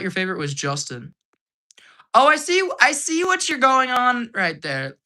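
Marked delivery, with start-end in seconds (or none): 2.1-5.0 high knowing tease, drawn out; oh I see you, playful; teasing. Real, not acted. Teasing a viewer's comment.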